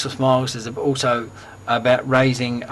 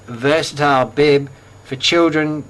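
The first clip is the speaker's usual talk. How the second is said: ordinary delivery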